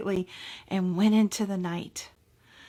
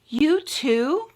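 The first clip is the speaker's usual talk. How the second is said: mouse voice; 5 semitones higher, 3 dB louder than usual. Acted. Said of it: "Mother Mouse asked".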